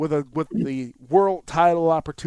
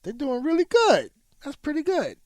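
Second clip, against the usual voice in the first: mock pleasant; 11 semitones higher than usual. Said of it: "And I went".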